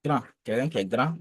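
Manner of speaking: ordinary talk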